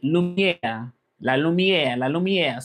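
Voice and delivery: "Lumière" as loo-myair; French pronunciation. Shown as a demonstration. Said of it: "How do we say light".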